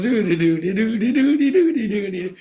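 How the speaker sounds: put-on playful baby babble; lightly voiced baby babble; baby babbling. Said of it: "the baby goes".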